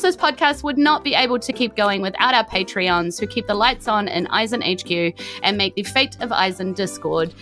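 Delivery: ordinary talk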